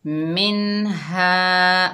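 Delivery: slow, drawn-out Quranic recitation. Shown as a demonstration. Teacher reciting the word for pupils, slow chanted recitation. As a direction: recite it slowly, like tilawat. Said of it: "एक अलिफ की मिकदार खींच कर पढ़ेंगे हा".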